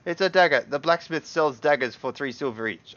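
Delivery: loud, emphatic shopkeeper protest; insistent haggling. Acted. Merchant haggling over a dagger, loud and insistent. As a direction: insist loudly, starting high and easing down.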